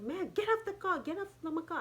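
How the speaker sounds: high-pitched imitation of an exasperated driver; driver's impatient order. Acted driver ordering passenger out.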